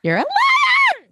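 high, loud, drawn-out yell; mock shout. Co-host yelling back at Gabriel, a mock shout. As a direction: hurl the accusation in a squeal. Echoing co-host's accusation, shouting.

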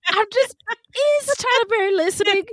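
high laughing plea. Real, not acted. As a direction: giggle through the question.

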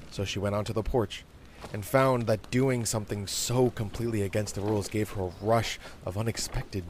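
plain reading voice

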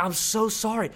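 high-pitched retold apology; upset apology. Golfer apologising to the man he blocked, retold as upset. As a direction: imitate someone who feels awful and says sorry. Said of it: "He felt so bad".

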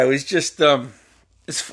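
normal talking voice

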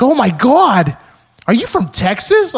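high, loud excited caller; old lady with cartoon accent. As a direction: imitate a thrilled old lady on the phone. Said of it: "she had the same fucking accent".

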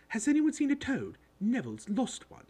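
high, loud, bossy voice; bossy girl. A narrator's reading. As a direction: demand an answer like a know-it-all schoolgirl. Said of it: "She had a bossy sort of voice".

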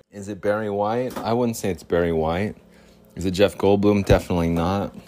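ordinary talk, nothing marked

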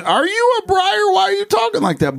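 adult mocking a child's put-on accent at dinner, high and teasing; mocking high kid voice. Mocking a kid's silly voice.

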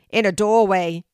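a lively group yell. Acted. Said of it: "a few yell together".

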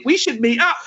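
high-pitched, overeager holiday suggestion; mock enthusiasm. Imagining acquaintances both in Spain, mock-keen. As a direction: send up someone suddenly keen to meet. Mocking holidaymakers' sudden keenness.

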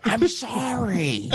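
put-on very slow, high drunken apology; hammed-up tipsy sorry. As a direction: imitate a whiny drunk, dragging it out. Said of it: "You always talk like that when you're drunk".

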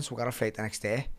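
ordinary delivery

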